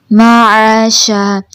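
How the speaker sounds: معاشا slow, with the a after ع stretched; long a in maasha. Shown as a demonstration. Teacher showing the student the stretched a. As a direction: draw out the middle a of معاشا. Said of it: "تھوڑا سا لمبا".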